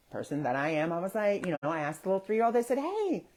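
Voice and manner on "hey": put-on high, soft sing-out to a child; gentle high-pitched greeting for a toddler; talking to small child. Bystander greets a lost three-year-old, child-directed voice.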